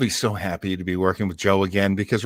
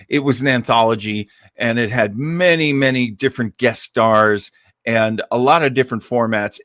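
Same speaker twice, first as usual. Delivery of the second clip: ordinary delivery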